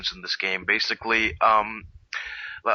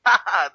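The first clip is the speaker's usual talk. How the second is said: loud, high imitation cackle; mimicked Joker laugh; 9 semitones higher, 9 dB louder than usual. Retelling the Joker losing it, mimicked loud laughing. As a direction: burst into a mock villain's laugh. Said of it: "He's just laughing".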